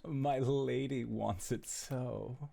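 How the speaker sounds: slow, measured in-character reply; devoted captain. Acted. Captain explaining his pursuit for his lady, slow and devoted. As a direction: answer with grave devotion.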